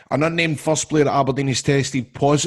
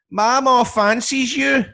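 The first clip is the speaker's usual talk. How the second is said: put-on childish blurt; 10 semitones higher, 5 dB louder, much slower than usual. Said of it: "and I went".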